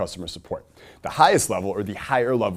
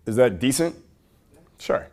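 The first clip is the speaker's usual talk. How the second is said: ordinary talk, nothing marked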